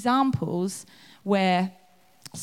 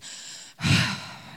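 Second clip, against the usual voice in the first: exaggerated sigh. Martha fetching things, sighing pointedly for attention. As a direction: sigh so everyone hears. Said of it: "walks over to get something and goes".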